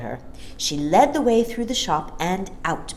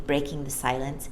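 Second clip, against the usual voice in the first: ordinary delivery, nothing marked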